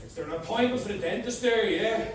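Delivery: quiet odd caller. Retold or imitated.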